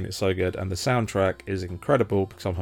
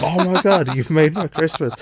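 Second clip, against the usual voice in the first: much higher and louder, bursting with joy; overjoyed shout; 9 semitones higher, 7 dB louder than usual. Retold or imitated. To the ex-fiance after opening the game, an overjoyed shout. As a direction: yell it like the best gift ever.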